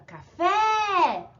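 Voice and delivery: café with FÉ long, high and loud; stronger stressed syllable. Shown as a demonstration. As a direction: stretch and lift the final syllable of "café". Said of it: "o acento é a mais forte".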